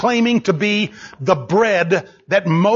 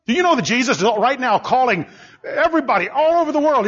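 ordinary talk, nothing marked

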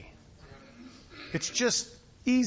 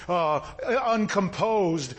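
normal talking voice

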